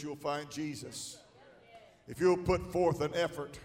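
ordinary delivery

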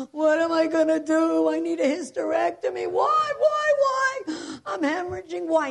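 loud, high-pitched mock wailing; hysterical crying. Roommate facing a hysterectomy, imitated hysterical crying. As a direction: wail it hysterically, acting out a sobbing woman. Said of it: "she's hysterical crying".

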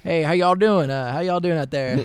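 loud, high, hammed-up Southern greeting; overly Southern accent. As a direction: holler it to the crowd in an exaggerated Southern accent. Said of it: "kind of an overly Southern accent".

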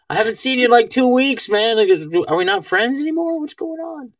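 high-pitched plaintive pleading; high, wounded mock appeal; mock hurt. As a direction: plead with a friend who abandoned you, hammed up. Acts out pining for Fauci.

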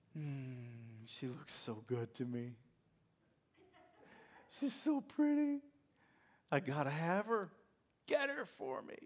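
soft, low, dreamy murmur; lovestruck Samson. Acted. Samson answering his parents, soft and lovestruck. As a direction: moon over her and ask softly.

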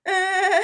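high, loud stunned uh; stunned grunt. Retold or imitated. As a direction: freeze with an awkward uh. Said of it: "And I was like".

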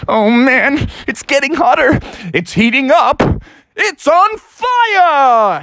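high excited voice climbing to a drawn-out yell; mounting hype, exploding on the last words; building excitement. Acted. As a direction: build to a stretched-out, high shout. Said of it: "he was getting more excited".

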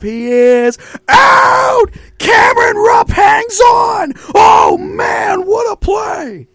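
high, slow shouted announcer call. Retold or imitated. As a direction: yell it like the radio man. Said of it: "you know what highlight I watch".

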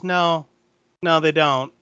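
ordinary talk, nothing marked

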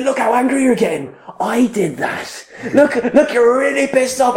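high, gleeful retold goading; worked-up, high-pitched needling; manic taunting. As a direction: taunt the angry heckler in a high voice. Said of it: "but I was manic".

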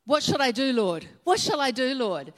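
loud, desperate plea to the Lord; loud, pleading cry to God. Retold or imitated. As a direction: call out from the ground, begging. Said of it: "cried out".

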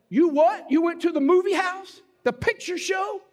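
hammed-up disbelief at a sinful outing; mock scandalized. Mimics wife's scandalized questioning.

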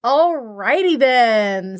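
slow deliberate wrap-up; slow drawn-out. Real, not acted. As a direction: stretch out "Alrighty then".

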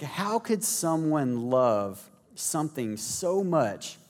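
normal talking voice

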